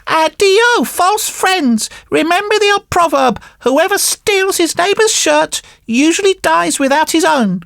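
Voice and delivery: high-pitched puppet voice, brushing them off; dismissive marionette. Acted. Marionette rebuffs the false friends, high and dismissive.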